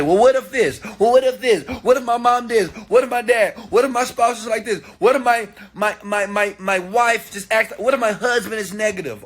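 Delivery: high, piled-up mock objections; mock excuse-making. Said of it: "it's so easy to say".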